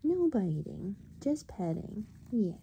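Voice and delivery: quiet, gentle scolding to a cat; soft pet talk. Real, not acted. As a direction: hush it tenderly to the kitten.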